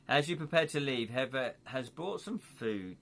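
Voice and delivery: normal talking voice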